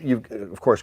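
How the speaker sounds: ordinary talk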